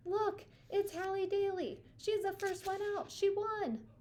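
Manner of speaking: high, loud screaming fan; excited screaming. A narrator's reading. A fan in the crowd screams as Hallie climbs out, high and loud. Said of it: "started screaming".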